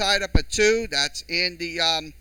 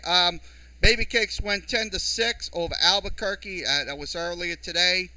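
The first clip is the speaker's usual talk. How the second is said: normal talking voice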